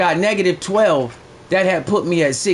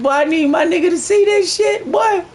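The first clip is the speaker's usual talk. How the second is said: hyped shout; 12 semitones higher than usual. Retold or imitated. Hyped retelling own shout.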